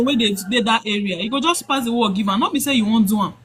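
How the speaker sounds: ordinary talk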